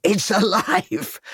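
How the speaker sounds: high, slow joyful cry. A narrator's reading. Lucy hearing the baby cry, high joyful cry. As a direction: cry out in joy. Baby cries after rescue.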